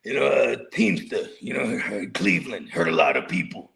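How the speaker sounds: mafia guy. Retold or imitated. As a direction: drop way low like an old mob boss telling war stories. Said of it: "they start telling mafia stories".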